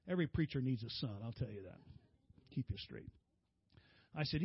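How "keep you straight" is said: ordinary talk, nothing marked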